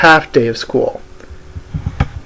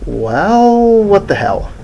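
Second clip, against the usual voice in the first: usual speaking voice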